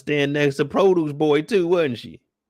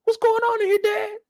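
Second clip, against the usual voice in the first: put-on much higher, worried girl; little girl voice. Little sister walking in on the fight, very high. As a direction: ask like a scared little girl. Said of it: "She's like".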